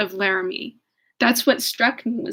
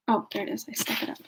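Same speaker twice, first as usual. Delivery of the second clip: ordinary talk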